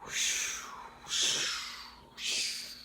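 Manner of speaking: soft breathy whooshing. Acted. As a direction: let the boat glide by. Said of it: "The boat goes".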